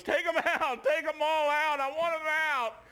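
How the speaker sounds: high, loud imitation wail; loud re-enacted tearful hollering; retold crying outburst. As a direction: cry it out desperately as the girl. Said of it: "and she's crying".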